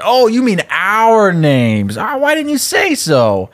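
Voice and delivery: high, drawn-out feigned realization; mock innocence. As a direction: play exaggeratedly innocent with the cop. Boy mock-innocently answers cop.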